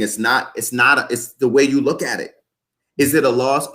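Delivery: ordinary talk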